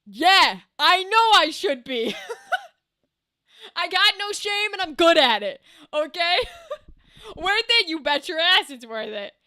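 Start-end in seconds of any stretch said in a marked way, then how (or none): 0.1-3.8 laughing. Real, not acted. Laughing at joke. 6.4-6.9 high squeaky laugh. Real, not acted.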